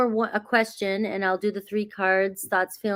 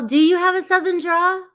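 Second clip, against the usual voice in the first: surprised double-take, much higher and louder; amused surprise; 9 semitones higher, 6 dB louder than usual. Real, not acted. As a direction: pitch jumps high in delighted disbelief. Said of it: "That's funny".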